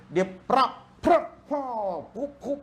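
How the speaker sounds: high loud cracking noises prak and kup; bone crack sounds. Retold or imitated. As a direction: mimic the snap of bones. Imitates bones cracking at chiropractor. (bunyi tulang berderak yang lantang)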